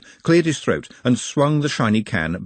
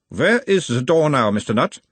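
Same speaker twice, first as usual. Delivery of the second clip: put-on a mild Uberwaldian accent, the higher voice of a gentle examiner; 5 semitones higher, 3 dB louder than usual. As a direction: question him in a light Uberwald accent, calming the patient. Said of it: "in a mild Uberwaldian accent".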